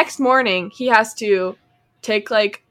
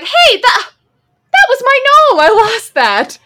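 high, loud outraged cry; indignant passerby; 13 semitones higher, 7 dB louder than usual. Retold or imitated. Passerby spotting his stolen gnome, high loud outrage. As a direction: claim back your lost gnome.

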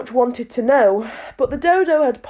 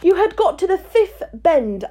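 put-on young girl voice; 8 semitones higher than usual. Alice speaks.

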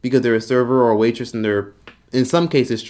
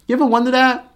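mocking high question; 11 semitones higher than usual. Real, not acted. Rhetorical jab at churchgoers.

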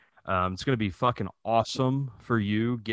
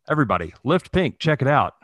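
mock ad plug; 3 semitones higher, 5 dB louder, faster than usual.

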